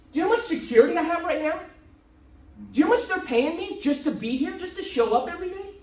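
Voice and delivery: a player defends his shoe-store deal, fast and high; insistent. Retold or imitated. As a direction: press the point hard and fast. Jordan defending his security.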